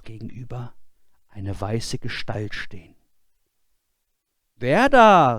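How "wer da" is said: loud, high call into the dark. Acted. Boy in the bell tower calling to the ghostly figure, loud. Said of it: "rief er".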